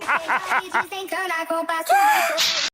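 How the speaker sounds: high laugh. Real, not acted. Laughing at the cat.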